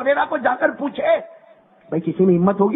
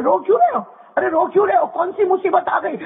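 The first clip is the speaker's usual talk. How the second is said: concerned question; 9 semitones higher than usual. Acted. The Prophet asks the weeping man why, concerned. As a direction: ask gently but urgently why he cries.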